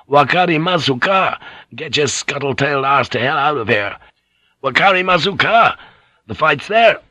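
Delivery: pressing, exclaimed command; urgent cry. A narrator's reading. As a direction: call it out to hurry them along. Urgent orders amid a battle.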